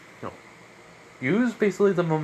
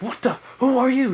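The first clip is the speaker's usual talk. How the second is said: high, louder startled question; mildly acted startled challenge; startled demand; 11 semitones higher, 4 dB louder than usual. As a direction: demand to know, pitched up. Robber startled by stranger.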